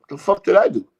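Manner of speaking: loud, high-pitched indignant question; indignant protest. Retold or imitated. Stopped passenger reacts to "we got him", indignant. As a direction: retell it as an outraged protest.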